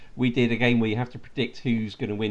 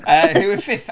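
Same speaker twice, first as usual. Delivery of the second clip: laughing; 13 semitones higher, 8 dB louder than usual. Real, not acted. Giggling while reading fixtures.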